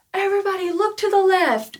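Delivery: high, loud call to the whole crowd; announcer calling out. Pretend. Concert announcer tells the crowd to look, loud high call.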